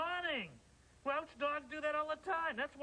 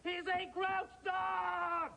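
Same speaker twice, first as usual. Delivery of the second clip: slow, raised, emphatic shout; angry; 5 semitones higher, much slower than usual. Acted. Grouch insists his dog doesn't play games, angry shout. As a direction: bellow it word by word. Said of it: "Because I'm angry".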